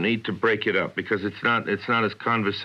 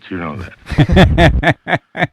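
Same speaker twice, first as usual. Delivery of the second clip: drawn out, much louder, scornful; contemptuous outburst; 7 semitones higher, 12 dB louder, much slower than usual. Real, not acted. Welles scorning the beef copy, loud and drawn out. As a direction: sneer the line out slowly.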